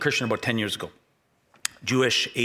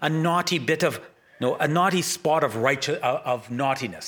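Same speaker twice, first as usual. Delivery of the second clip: a light imitation of a British accent, a bit higher; 4 semitones higher than usual. Pastor quoting the British video narrator in a light British accent. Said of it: "I just heard in his British accent".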